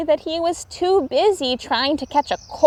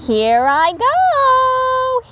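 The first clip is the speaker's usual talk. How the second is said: high, slow, louder call; shout; 13 semitones higher, 5 dB louder, much slower than usual. A narrator's reading. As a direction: call out as you leap in. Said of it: "he shouted".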